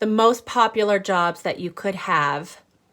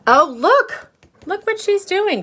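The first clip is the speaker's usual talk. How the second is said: excited; 13 semitones higher, 9 dB louder than usual. Real, not acted. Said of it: "reading to children, showing picture".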